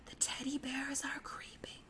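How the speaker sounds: very soft whisper of teddy bears are creeping. Shown as a demonstration. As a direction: whisper the line barely audible. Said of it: "even quieter this time".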